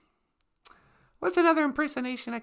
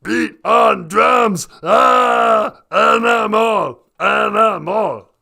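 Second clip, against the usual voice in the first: loud, slow, low monster roar; shouting puppet drummer, deep and growly; Animal; 5 semitones lower, 11 dB louder, much slower than usual. Acted. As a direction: bellow wildly, dragging out each word. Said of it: "He was like".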